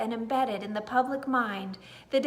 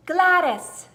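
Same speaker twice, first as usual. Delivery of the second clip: loud, high ringing name; trumpeting call; 5 semitones higher, 10 dB louder than usual. A narrator's reading. Trumpet vine announcing the new name, trumpeting. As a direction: blare it like a trumpet. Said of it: "called out one word".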